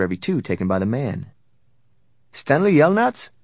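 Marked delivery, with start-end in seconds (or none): 2.3-3.3 high, loud questioning call. A narrator's reading. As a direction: call out a name, pitched up. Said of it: "she called".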